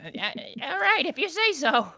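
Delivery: high-pitched, reluctant goblin underling; hesitant goblin. Acted. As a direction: squeak it out uncertainly as a goblin. GM voices hesitant goblin reply.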